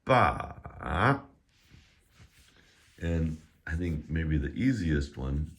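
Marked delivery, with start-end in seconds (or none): 0.1-1.2 BA drawn out, down then back up; dipping tone. Shown as a demonstration. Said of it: "goes down and then it comes back up".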